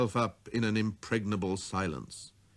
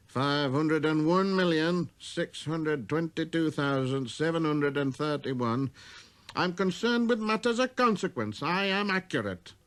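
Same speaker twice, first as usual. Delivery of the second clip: self-important, slightly higher voice; pompous businessman; 6 semitones higher than usual. Acted. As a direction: lecture with busy importance. Businessman insists.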